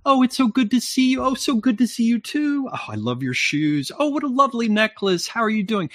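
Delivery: high lilting women's small talk; singsong chat. Retold or imitated. Women greeting each other, high singsong compliments. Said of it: "Everybody's singing".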